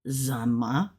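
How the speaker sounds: "zunma" with a buzzed z, not an s. Shown as a demonstration. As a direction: say "zunma" slowly, z at the front. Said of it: "like, not sun".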